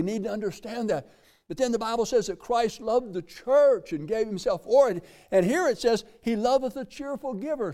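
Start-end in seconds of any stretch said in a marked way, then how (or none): 1.5-7.7 preaching emphasis. Acted.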